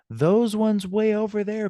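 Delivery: pitched high and loud to show distance; far away emphasis. Shown as a demonstration. Teacher showing students how far away, high and loud. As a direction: stretch it out as if pointing far off.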